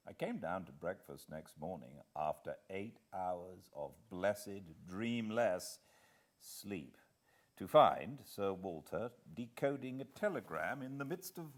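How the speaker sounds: measured fireside storytelling; story narration. A narrator's reading. Narrator opening the chapter for fireside listeners, calm storytelling. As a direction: read it like a cosy fireside audiobook. Reads the novel aloud.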